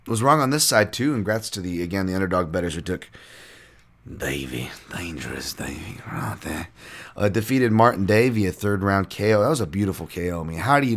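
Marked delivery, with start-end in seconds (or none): 4.1-6.7 low, whispered creepy drawl. Retold or imitated. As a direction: creep the name out slowly. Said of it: "creepy accent there".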